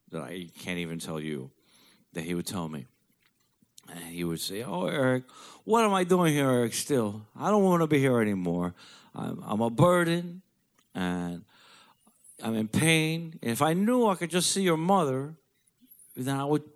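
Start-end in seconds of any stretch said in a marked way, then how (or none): 4.6-16.6 put-on pleading old father, pitched up; retelling dad's tired plea, raised pitch; imitating his father. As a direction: plead like your weary old dad. Father's plea retold to son.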